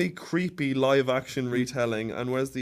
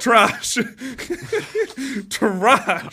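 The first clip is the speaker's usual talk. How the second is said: laughing outburst; 5 semitones higher than usual. Real, not acted. Keeps repeating verdict, laughing.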